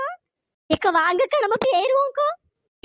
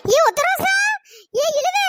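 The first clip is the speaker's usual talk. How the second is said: high, loud yell of their names; panicked loud calls; shouting after them; 5 semitones higher, 5 dB louder, slower than usual. Acted. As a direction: scream for friends to wait. Shouting after fleeing friends.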